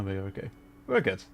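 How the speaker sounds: usual speaking voice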